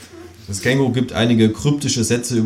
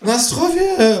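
high, drawn-out kangaroo cheer; calling a toast. A narrator's reading. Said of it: "ruft das Känguru".

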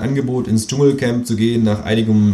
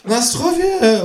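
much higher kangaroo cheer; toasting call. A narrator's reading. Kangaroo toasts the narrator with vodka, calling out. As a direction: raise a glass and call it out. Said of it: "ruft das Känguru".

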